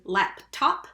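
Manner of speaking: "laptop" slowly, as two clear beats, lap-top; split into syllables. Shown as a demonstration. Teacher asking for the second syllable, "laptop" slowly split. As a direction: stretch "laptop" so each syllable stands apart. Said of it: "what's the second syllable".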